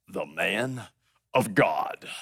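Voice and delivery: very low, solemn half-whisper; hushed grand title. Acted. Preacher announcing Elijah's title, hushed and very low. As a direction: sink low and soft for a weighty reveal.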